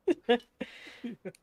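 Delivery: loud high-pitched cackle; high loud laugh. Real, not acted. Laughing hard in the middle of the cashier bit.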